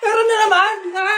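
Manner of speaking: high, full-voiced cry; loud exclamation. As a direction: yell it out over the noise. Exclaims after New Year noise. (pasigaw na bulalas)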